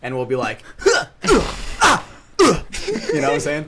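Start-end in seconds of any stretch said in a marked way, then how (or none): 0.8-2.6 high, loud sword-swing grunts; cringy anime battle grunts. Retold or imitated. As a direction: overdo the fighting grunts. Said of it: "some cringy voice acting".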